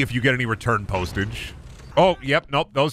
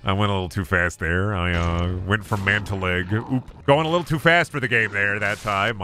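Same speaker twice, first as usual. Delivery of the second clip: ordinary talk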